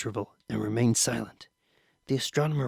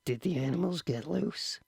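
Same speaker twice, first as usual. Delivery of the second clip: softer secret question; hushed; 5 semitones higher, 6 dB quieter than usual. A narrator's reading. Boy secretly asks friend at table, softer. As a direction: ask it under your breath. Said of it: "soundless words".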